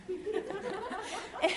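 soft high giggle; a short real laugh under the breath. Real, not acted. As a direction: break into a quiet giggle. Laughing at her own story.

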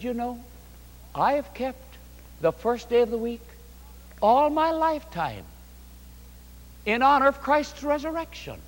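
high, soft voice of an earnest grandmother; retold pious protest. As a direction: imitate a mother-in-law gently standing on principle. Grandmother solemnly defends her long observance.

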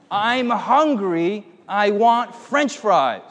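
high, very drawn-out whine; mock whining kid; upset little child. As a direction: stretch it into a childish wail. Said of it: "The little child was very upset".

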